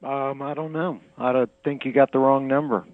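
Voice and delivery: ordinary delivery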